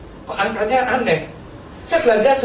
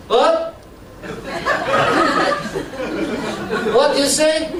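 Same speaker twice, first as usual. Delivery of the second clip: rude shopkeeper; 6 semitones higher, much slower than usual. Retold or imitated. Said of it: "Saudara tahu jawabnya kan?".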